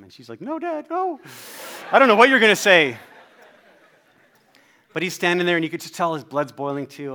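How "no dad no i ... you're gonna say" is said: put-on very high little-kid protest, getting loud; panicking child. Daughter begging dad not to approach him, panicky. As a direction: plead high, then burst out loudly.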